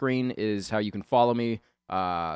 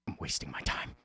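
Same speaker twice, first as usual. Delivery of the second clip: muttered aside, whispered; 7 semitones lower, 6 dB quieter than usual. Retold or imitated. Retold line said dismissively.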